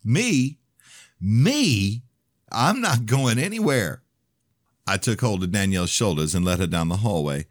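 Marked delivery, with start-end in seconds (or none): 0.0-4.0 high, louder, stretched-out indignant protest; on the edge of hysteria; near hysterical. A narrator's reading. Danielle refusing to leave the room, near hysterical. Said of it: "Danielle was a hair away from hysterical".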